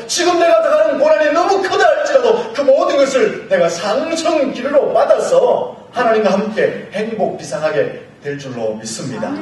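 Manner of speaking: ordinary talk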